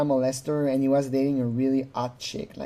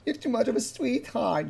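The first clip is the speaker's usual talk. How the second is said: softer, syrupy compliment; imitating a sweet-talker; 7 semitones higher, 5 dB quieter than usual. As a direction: coo it softly like a smooth talker. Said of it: "He's very good".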